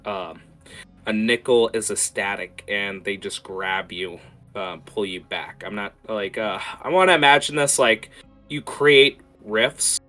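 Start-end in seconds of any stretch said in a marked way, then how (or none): none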